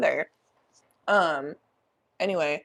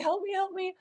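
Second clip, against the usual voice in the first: very high, softer frightened begging; shaky pleas; 12 semitones higher, 5 dB quieter than usual. Retold or imitated. Shaking on the ground, begging her friend, high and soft. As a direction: whimper it out, scared stiff. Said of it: "crying, like, shaking".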